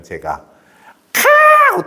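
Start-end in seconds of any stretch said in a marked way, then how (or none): 1.1-1.9 very high sharp yelp; a piercing squawk of anger; shrill exclamation. Retold or imitated. Father's sharp high outburst at his child, shrill. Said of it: "톤이 높아요".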